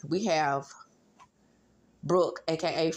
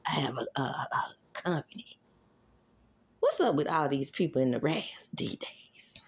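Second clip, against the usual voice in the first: whispering; 6 semitones lower, 3 dB quieter than usual. Real, not acted. Aside spoken under breath.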